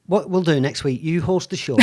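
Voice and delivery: usual speaking voice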